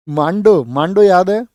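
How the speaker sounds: loud call to the class. Real, not acted. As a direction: call it out to wake the room. Teacher calls class to attention.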